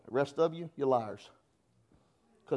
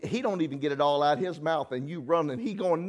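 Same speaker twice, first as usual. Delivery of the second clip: ordinary talk, nothing marked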